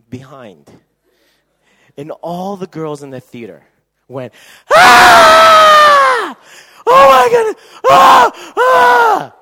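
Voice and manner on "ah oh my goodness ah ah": very high, very loud squealing; fangirl screams. Retold or imitated. Girls in the theater screaming at the hero, shrill squeals. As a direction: scream like swooning fans.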